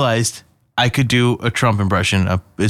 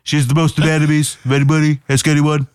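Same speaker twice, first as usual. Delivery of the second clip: a light Trump, bragging with pouted lips; lightly voiced Trump, boasting about an actress; Donald Trump voice; 3 semitones higher than usual. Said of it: "That's how you do a Donald Trump impression".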